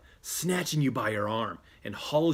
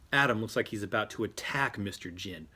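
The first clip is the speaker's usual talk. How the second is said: usual speaking voice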